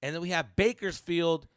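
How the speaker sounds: loud, high, slow announcer read; tour announcement. Real, not acted. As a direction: announce the dates like a hype man.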